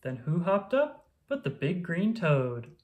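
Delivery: ordinary delivery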